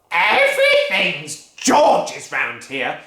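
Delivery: bully the whole family; furious Grandma. Acted. Grandma demanding her breakfast, loud bullying bellow. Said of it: "shouted Grandma".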